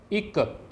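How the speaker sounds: "इक" with short i and a doubled, released k; Punjabi pronunciation. Shown as a demonstration. Teacher reading the new word to learners, "इक" pronounced ikk. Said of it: "इसका उच्चारण है इक".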